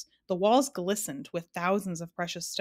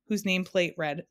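usual speaking voice

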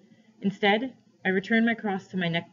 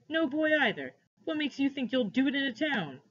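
higher-pitched derisive questioning; scoffing; 6 semitones higher than usual. A narrator's reading. Man ridiculing the boy's hopes, scoffing. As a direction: pour doubt on it, pitch lifted. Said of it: "he laughed".

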